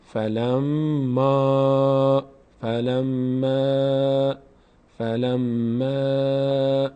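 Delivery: فلما with the final ا held very long; slowly stretching فلما so students hear the madd; stretched vowel. Shown as a demonstration. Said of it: "ما این الف رو باید بکشیم".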